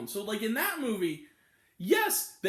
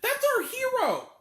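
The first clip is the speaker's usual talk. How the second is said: mock hero announcement; 11 semitones higher, 4 dB louder than usual. Mock praise after movie clip.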